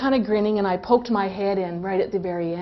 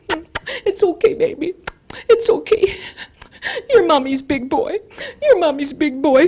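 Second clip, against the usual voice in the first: high, tender, partly whispered comfort; soothing baby talk; 5 semitones higher than usual. Retold or imitated. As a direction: hush a hurt toddler gently. Mother soothing crying toddler.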